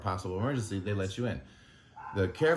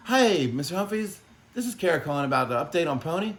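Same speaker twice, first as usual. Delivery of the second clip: much higher and louder, sing-song mock of a chipper caller; mocking the doctor's voice; 9 semitones higher, 6 dB louder than usual. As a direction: parody her bright phone manner, pitched way up. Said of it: "this is how she sounded".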